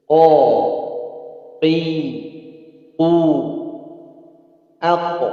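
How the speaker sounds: one syllable at a time for students to copy; qaf drill. Shown as a demonstration. Teacher leading a qaf drill for students to repeat, deliberate. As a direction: recite Ko, Qi, U, Ak, Iq, Uq with a heavy, held Q.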